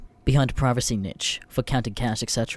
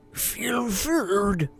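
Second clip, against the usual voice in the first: grumbled words; 8 semitones higher than usual. Acted. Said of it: "She grumbles something like".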